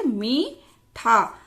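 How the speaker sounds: मीठा very slow, mee held long; drawn-out vowel. Shown as a demonstration. Teacher writing out the example, slow stretched मीठा. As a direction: split मीठा into two long syllables. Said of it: "थोड़े खींचकर लंबे समय के लिए".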